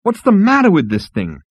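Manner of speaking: high-pitched exasperated demand; spat. A narrator's reading. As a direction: spit the question out in frustration. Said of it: "he spat".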